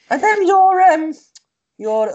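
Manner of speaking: a hint of Scouse, badly done; Matt's Scouse voice. Mimicking Matt's question in a shaky Scouse. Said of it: "I'll do it in his voice".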